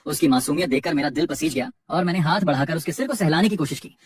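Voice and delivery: plain reading voice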